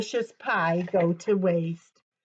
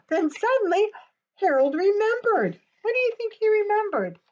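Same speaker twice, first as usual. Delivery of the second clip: excited; 12 semitones higher, faster than usual. A narrator's reading. Sudden realisation in story.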